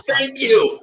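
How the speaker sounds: very high mock-grateful thank you; sarcastic thanks. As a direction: squeak it out, falsely polite. Sarcastic reply to friend.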